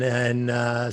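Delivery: normal talking voice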